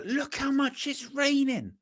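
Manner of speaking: high, drawn-out dad marvelling at rain; amazed dad. Acted. Dad looking outside at the rain, amazed. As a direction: stretch it out slowly in a high voice. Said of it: "Dads, it rains and they look outside".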